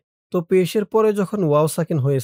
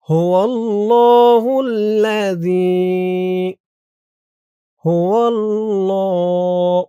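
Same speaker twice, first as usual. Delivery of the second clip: slow drawn-out chant, higher and louder; tajweed recitation; 3 semitones higher, 5 dB louder, much slower than usual. Shown as a demonstration. Teacher chanting for students to repeat. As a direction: stretch হুয়াল্লাহুল্লাযী long with the vowels held. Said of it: "বলুন আমার সাথে".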